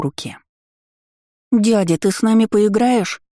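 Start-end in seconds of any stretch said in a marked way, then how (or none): 1.5-3.2 put-on high whiny little girl begging; capricious whining drawl. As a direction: drawl it like a spoiled child. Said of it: "капризно протянула Эйка".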